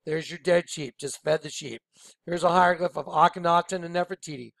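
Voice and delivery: normal talking voice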